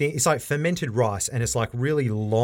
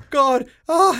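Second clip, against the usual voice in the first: high, loud hammed-up whimper of a man about to fall; mock whimper; 5 dB louder than usual. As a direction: play-act a scared cry while dangling. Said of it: "he's whimpering 'cause he's about to fall".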